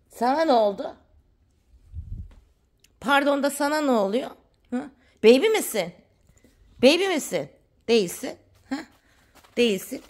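high-pitched playful telling-off; mock scolding. Scolding a pet for bringing fibre, high mock threat.

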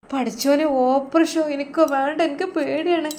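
frightened plea, close to tears; high-pitched scared whimper at the word operation; scared and tearful. Acted. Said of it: "Rasheed ഉമ്മ കരയാൻ തുടങ്ങി".

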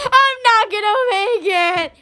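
high mock wail; imitating her. Retelling a friend desperate for the bathroom, high mock wail. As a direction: mimic her desperate cry, pitched high. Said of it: "And then she's like".

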